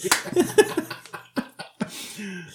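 drawn-out, wheezy high laughing fit; long real laugh. Real, not acted. Friends recalling Movie forty-three, helpless laughter. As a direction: laugh yourself out of breath.

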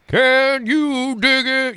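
slow, high, drawn-out jive line; 1940s jive talker. Shown as a demonstration. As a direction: stretch it out like a cool street leader. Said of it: "you just would nail that guy's voice".

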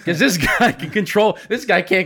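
high, slow, laughing through words. Real, not acted. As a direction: say it while laughing. Laughter heard on lines.

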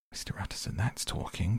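ordinary delivery

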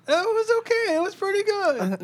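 high mocking voice of a friend; high, slow mimic of his praise; mocking impression. Mocking Mike's change of opinion.

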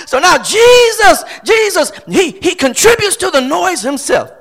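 high, loud preaching shout; exclaiming preacher. Real, not acted. Preacher saying Jesus joins the noise, exclaiming. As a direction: cry out the name up high.

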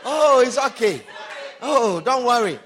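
loud, breezy mock reassurance from the boyfriend; doting boyfriend. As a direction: play the easygoing boyfriend, loudly. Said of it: "He said".

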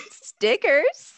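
happy surprise. Retold or imitated. Mimicking her co-host's happy stickers. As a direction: imitate a delighted little discovery. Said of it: "you were so happy".

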